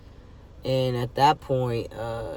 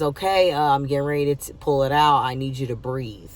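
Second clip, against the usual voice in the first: loud, higher-pitched heads-up; retold nurse's warning; 3 semitones higher, 7 dB louder, faster than usual. Nurse warns patient before pulling out catheter, loud heads-up.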